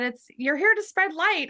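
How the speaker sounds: hint of a high, tinny feminine archangel; high-pitched angel voice. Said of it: "she had this really high-pitched, tinny kind of voice".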